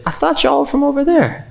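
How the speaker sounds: very high, insistent Southern lady; Southern accent. Retold or imitated. Southern lady doubting Muslims are local, Southern accent. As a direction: mimic her high Southern twang. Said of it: "in a very Southern accent".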